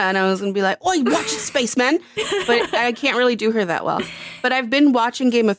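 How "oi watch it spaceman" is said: higher, rough-attempt British accent; half-successful British impression; Donna. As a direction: call out in a mock British accent. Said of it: "I was gonna try to do Donna".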